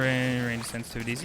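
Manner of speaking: ordinary talk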